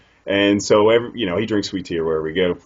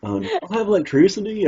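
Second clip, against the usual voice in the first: self-mocking Southern interviewer, pitched up; dumb-sounding Southern drawl; 5 semitones higher than usual. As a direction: exaggerate your Southern accent to sound slow-witted. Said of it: "the way that he sounds, listen to him".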